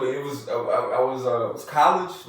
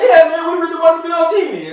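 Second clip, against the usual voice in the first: high, loud, fast eager reply; hammed-up excited holler; excited shout; 12 semitones higher, 9 dB louder, faster than usual. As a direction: yell back at the radio, mock-thrilled. Retells answering the commercial eagerly.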